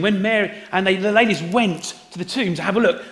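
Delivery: ordinary talk, nothing marked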